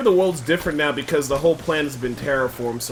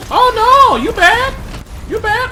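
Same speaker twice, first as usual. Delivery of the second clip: very high, loud sing-song mockery; mocking taunt; 8 dB louder than usual. Real, not acted. Gamer mocking a beaten enemy, high mocking taunt. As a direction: squeal it out to mock the beaten foe.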